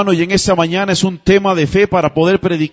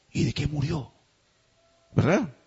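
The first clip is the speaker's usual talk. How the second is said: hushed murmur at a wake; gossip murmur; 4 semitones lower, 12 dB quieter than usual. Retold or imitated. Mourner gossiping at a funeral wake, hushed. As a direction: ask it under your breath like a nosy mourner. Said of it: "Usted llega a una vela".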